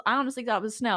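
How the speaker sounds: usual speaking voice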